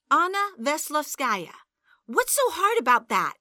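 put-on angry at her boyfriend's excuse; indignant outrage. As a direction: throw the name back at him. Angry spelling out of the name.

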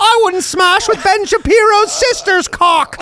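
high, slow impression; high Peterson-style voice. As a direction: lecture it like Peterson.

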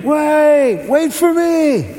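high, drawn-out mock yell; retold scream. Big kid running after the van, mock screaming. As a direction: imitate a desperate call from afar, stretched and high. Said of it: "he's screaming".

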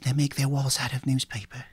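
ordinary delivery

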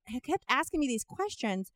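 ordinary delivery

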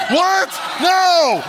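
high, shouted mock horror; hammed-up panicked outburst; freaking out. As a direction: yell it high like an alarmed kid. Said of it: "I'm freaking out".

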